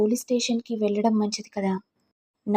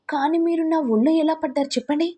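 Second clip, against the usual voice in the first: higher, indignant question; incredulous demand; 6 semitones higher than usual. Acted. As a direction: challenge him in disbelief. Demanding explanation in quarrel.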